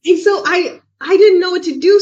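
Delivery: ordinary talk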